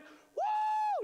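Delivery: high, loud mock party yell; party whoop. Older son mimicking his partying brother, loud high whoop.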